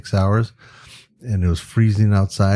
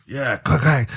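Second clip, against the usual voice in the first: higher actor impression with clack; Al Pacino; 4 semitones higher, slower than usual. Actor rocking as he poses, lightly mimicked clacking. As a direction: mimic the star echoing the shutter. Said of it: "they're both talking like".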